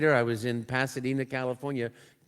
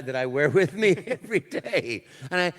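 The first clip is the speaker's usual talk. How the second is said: ordinary delivery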